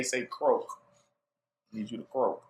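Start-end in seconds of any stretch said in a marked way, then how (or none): 1.7-2.4 put-on lower, softer greedy kid muttering. Low, soft greedy kid voice.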